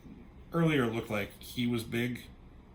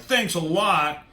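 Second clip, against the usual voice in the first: mock annoyed; 5 semitones higher, 6 dB louder, slower than usual. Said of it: "they're fucking pissed".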